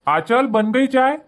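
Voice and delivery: high, drawn-out call. Acted. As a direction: call to wife in the kitchen. Husband calls to wife in kitchen.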